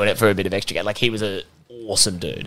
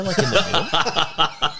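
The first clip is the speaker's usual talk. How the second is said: laughing; 9 semitones higher, slower than usual. Real, not acted. Spotting security guard in crowd.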